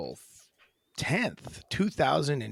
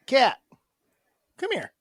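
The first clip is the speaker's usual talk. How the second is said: high cooing call to the cat; sing-song pet call; 13 semitones higher, 8 dB louder than usual. Real, not acted. Calling the cat.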